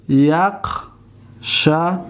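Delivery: یقشعر drawn out with a rising end; dictating یقشعر slowly; very slow dictation. Shown as a demonstration. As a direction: stretch یقشعر for writing. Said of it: "لم".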